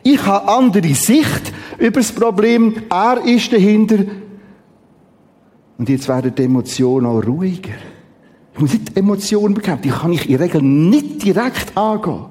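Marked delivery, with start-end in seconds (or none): none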